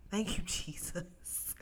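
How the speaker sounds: grateful laughing prayer; laughing thanks. Retold or imitated. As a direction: let relief break into a laugh. Retold relief, laughing.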